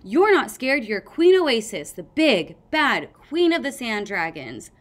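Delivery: loud, urgent prompting; loud encouragement. A narrator's reading. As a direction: pump her up, loudly. Tsunami urging Sunny on.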